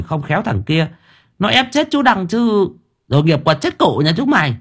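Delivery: high-pitched, scornful village gossip; indignant busybody chatter; gossiping neighbours. A narrator's reading. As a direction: tut and tattle about the scandal next door. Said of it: "túm tụm vào chỉ trỏ bàn tán".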